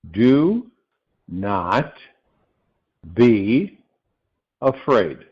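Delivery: each word set apart by a long pause; emphatic, halting word-by-word delivery; solemn, word by word. Real, not acted. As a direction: deliver it slowly as a weighty message, one word at a time. Solemn key message, word by word.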